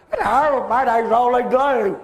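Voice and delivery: slow teasing impression of a mate; imitating Roland. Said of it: "that's how he really talks".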